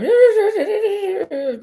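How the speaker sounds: high, loud trilled babble; drawn-out high rolling noise, falling at the end; imitated noise. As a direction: imitate a boy's noisy high-pitched trilling. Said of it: "making noises".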